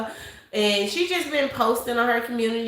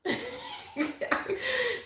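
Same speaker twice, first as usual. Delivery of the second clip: sudden high-pitched laugh; 14 semitones higher, 8 dB quieter than usual. Real, not acted. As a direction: burst out laughing. Laughing at a viewer comment.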